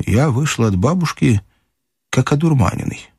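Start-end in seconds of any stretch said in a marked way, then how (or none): none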